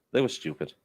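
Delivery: usual speaking voice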